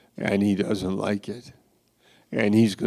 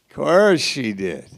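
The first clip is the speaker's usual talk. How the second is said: higher, louder knowing "Of course she did"; knowing sarcasm; 5 semitones higher, 4 dB louder than usual. Acted. Preacher hinting at Michal's motives, knowing sarcasm. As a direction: toss it off with a knowing lilt.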